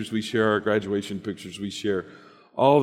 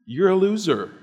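high, loud sneering taunt; accuser's taunt; 8 semitones higher, 5 dB louder than usual. Acted. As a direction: sneer it like the serpent. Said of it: "from the serpent who says".

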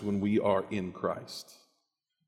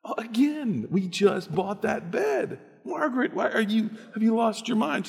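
much higher exasperated yelp; exasperated husband; 11 semitones higher than usual. Acted. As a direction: throw up your hands at her. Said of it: "would she sleep in our bed".

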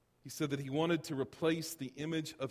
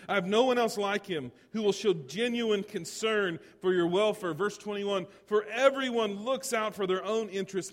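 high, loud pulpit reading; forceful scripture reading; 7 semitones higher, 9 dB louder than usual. Acted. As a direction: proclaim the verse with full energy. Preacher reads scripture with force.

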